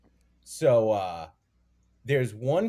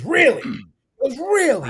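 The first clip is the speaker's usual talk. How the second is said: squeaky high amazed dad; 12 semitones higher, 8 dB louder, slower than usual. Retold or imitated. Dad praising his son's Kermit impression, squeaky amazed. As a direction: gush like an astonished father.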